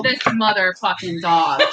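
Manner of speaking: ordinary delivery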